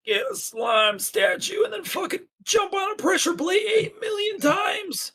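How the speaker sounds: put-on high, shouted old-timer whine; old-timey voice. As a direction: wail it like an outraged grandpa. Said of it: "they're saying it in an old-timey voice".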